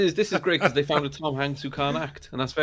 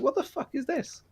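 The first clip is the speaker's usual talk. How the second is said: high-pitched incredulous retelling; mock bafflement; 13 semitones higher, 4 dB quieter than usual. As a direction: voice shoots up in disbelief. Retells own baffled reaction to film.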